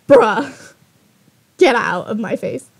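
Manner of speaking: mildly angry brush-off; pissed off. Acted. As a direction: dismiss him in irritation. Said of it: "I'm just pissed at him".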